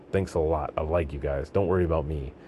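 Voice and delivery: plain reading voice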